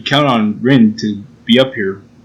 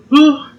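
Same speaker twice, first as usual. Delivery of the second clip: high, sudden mock swoon; hammed-up gasp of delight; 13 semitones higher, 3 dB louder than usual. Lovestruck at her grin, mock swooning.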